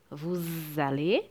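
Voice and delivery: "vous allez" slowly, joined with a buzzing Z; Z liaison. Shown as a demonstration. Teacher showing students the S read as Z in "vous allez", Z liaison. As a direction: stretch the linking Z in "vous allez". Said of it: "que tem o som de Z".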